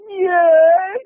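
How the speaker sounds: high, tearful mock whimper; Marsha Brady. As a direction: answer as if just hit on the nose. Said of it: "like Marsha Brady when the football".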